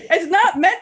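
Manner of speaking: high, loud protest through laughter; laughing. Real, not acted. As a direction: laugh while denying it. Laughing at dirty joke.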